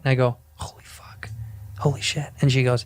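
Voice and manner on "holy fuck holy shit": breathless whisper of disbelief; hushed so the celebrity can't hear; awed whisper. Retold or imitated. Sees Norm Macdonald in the café, whispering in awe.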